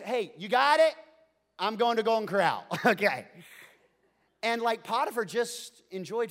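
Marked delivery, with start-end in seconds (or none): 0.0-2.7 loud, breezy, fast sign-off; carefree boss. Pretend. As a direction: toss it off like a boss heading to lunch. Playing carefree Potiphar.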